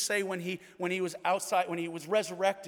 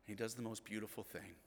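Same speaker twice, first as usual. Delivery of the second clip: ordinary delivery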